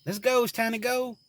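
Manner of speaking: put-on high pleading child voice; imitating his little girl, high and pleading; little daughter voice. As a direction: play his three-year-old begging him. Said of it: "My daughter was like".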